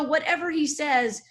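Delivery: usual speaking voice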